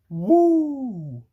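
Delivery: long high, loud "woo"; loud rising whoop that falls away; emotional whoop. Real, not acted. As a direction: blow off the feeling with a high woo. Overcome, steadying self on camera.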